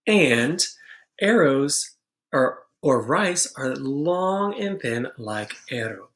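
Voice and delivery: ordinary delivery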